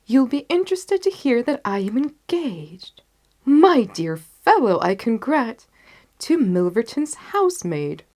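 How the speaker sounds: springing a surprise on a friend; Holmes amused. Acted. Holmes voiced in story.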